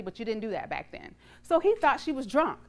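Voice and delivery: usual speaking voice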